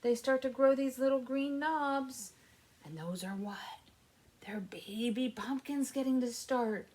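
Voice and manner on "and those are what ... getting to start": put-on a hushed whisper to the kids. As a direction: share it like a little secret. Secret aside to children.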